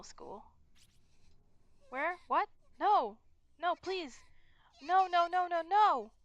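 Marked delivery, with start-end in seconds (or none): 1.9-6.1 loud, higher panicked no's; panicked pleading. Acted. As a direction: beg for it to stop. Mayu panics in game.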